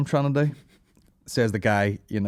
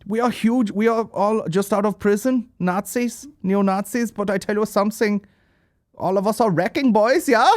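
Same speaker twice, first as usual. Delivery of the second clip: high, hammed-up German accent; pitched-up mock-German boasting; imagined German fan; 10 semitones higher, 4 dB louder than usual. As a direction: play an over-keen German fan. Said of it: "imagine I was leaving a gig and some guy's like".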